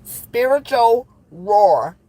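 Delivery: slow, drawn-out tongue twister; drawn out deliberately; slow careful say. Shown as a demonstration. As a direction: spell it out slowly, word by word. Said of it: "Trying to say that shit three times fast".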